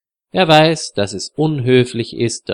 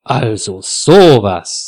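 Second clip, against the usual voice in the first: slow, louder indignation; indignant; 5 dB louder, slower than usual. A narrator's reading. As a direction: draw it out, scandalised, leaning on SOWAS. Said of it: "sagt Frieda entrüstet".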